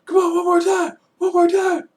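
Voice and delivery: high, loud mock scream; imitating a scream. Triple H taunting Reigns, screaming at full force. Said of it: "you can actually hear him screaming".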